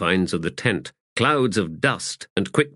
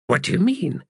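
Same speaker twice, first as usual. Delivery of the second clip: lightly voiced character; 10 semitones higher than usual. A narrator's reading.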